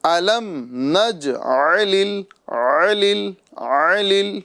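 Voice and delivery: نجعل ال very slow, ع from the throat; drawn-out recited نجعل ال with full ain; clear ain. Shown as a demonstration. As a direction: model نجعل ال slowly, leaning on the throaty ع. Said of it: "عین کی آواز نکالنا ہے".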